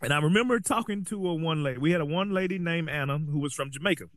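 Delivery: ordinary delivery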